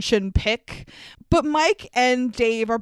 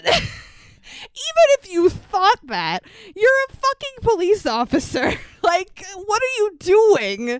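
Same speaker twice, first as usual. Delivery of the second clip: exasperated; 8 semitones higher than usual. Real, not acted. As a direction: plead in disbelief at the clueless cop. Exasperated rant at the cop.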